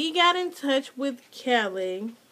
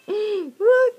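gasp, then a high loud look; delighted gasp; 10 semitones higher, 5 dB louder than usual. Real, not acted. Vlogger shows the envelope to viewers with a delighted gasp.

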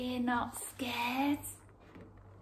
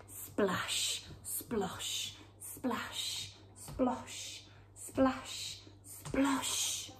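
soft breathy sound words for wading; whispered splashes; 3 semitones higher, 7 dB quieter, much slower than usual. Acted. Reading to children, whispered water sounds. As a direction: hush the splash and splosh.

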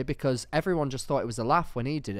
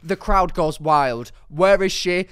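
excited build-up; 6 semitones higher, 10 dB louder than usual. Pretend. Hyping imagined stage scene.

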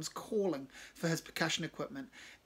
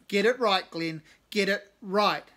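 stern scolding; 4 semitones higher, 9 dB louder than usual. Acted. Cave scolding Glenn.

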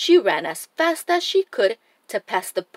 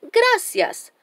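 bright high-pitched exclamation of thanks; delighted storybook exclamation; exclaiming; 12 semitones higher than usual. A narrator's reading. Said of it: "Dora exclaimed".

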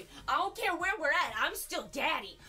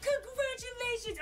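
excited shout; 7 semitones higher than usual. Real, not acted. Cheering a viewer's news.

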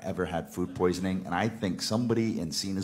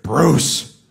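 louder, slow laughing disbelief; loud laughing accusation; 4 semitones higher, 9 dB louder, much slower than usual. Retold or imitated. Vince turning on Bruce Pritchard, loud laughing disbelief. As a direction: call him out, laughing hard. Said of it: "He looks at Bruce Pritchard".

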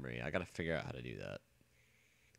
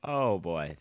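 ordinary talk

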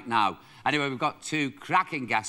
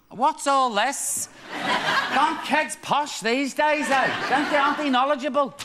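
lightly voiced old-timer, high and slowed; fusty old-timer voice; 9 semitones higher, 3 dB louder than usual. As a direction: ham up a nostalgic old codger. Mocking posh old-fashioned reminiscing.

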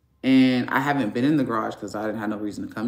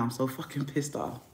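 near tears; 8 dB quieter than usual. Real, not acted. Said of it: "I'm about to cry right now".

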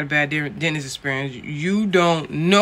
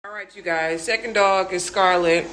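ordinary talk, nothing marked

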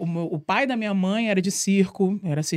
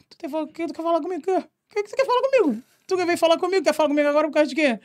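much higher, quick, confrontational; defiant challenge; 9 semitones higher, faster than usual. Retold or imitated. Girl confronting her former bully, high and fast and provocative. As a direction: get in his face, fast.

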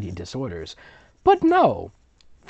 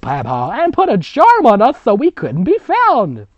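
hammed-up story reading; 12 semitones higher, 12 dB louder than usual. Reading a dramatic witch story.

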